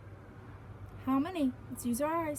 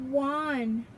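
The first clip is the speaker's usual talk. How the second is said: ordinary delivery, nothing marked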